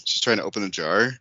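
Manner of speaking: usual speaking voice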